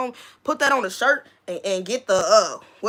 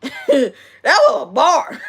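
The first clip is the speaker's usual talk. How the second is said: slow, higher, through laughter; laughing; 6 semitones higher, 3 dB louder, slower than usual. Real, not acted. Streamer tickled by her own line, laughing. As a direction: crack up while savoring the words. Repeats it, cracking up.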